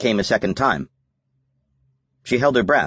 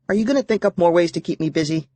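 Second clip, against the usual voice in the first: put-on young girl voice; 9 semitones higher than usual. A narrator's reading. Sydney teasing Mitch on phone.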